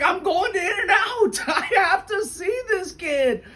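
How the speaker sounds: high, giggly imitation of the thrilled librarian; laughing excitedly. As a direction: laugh through it, eager to go.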